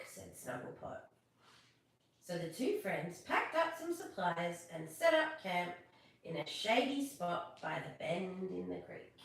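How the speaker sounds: ordinary delivery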